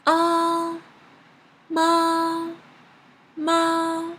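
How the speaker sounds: "Ah" and "ma" loud, high and level; first tone. Shown as a demonstration. Teacher practising the second word, high flat "ma". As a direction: hold "ma" on one ringing high note. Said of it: "It's the first tone".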